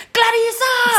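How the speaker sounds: high melodramatic soap-opera yell; Sergio Goyri. Retold or imitated. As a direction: wail the name like a telenovela actor. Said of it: "Sergio Goyri y gritaba".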